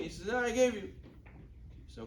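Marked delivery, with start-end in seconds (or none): 0.3-0.9 higher-pitched firm contradiction; insistent acted denial. Father repeating himself to his son, insistent. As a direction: play a father standing his ground.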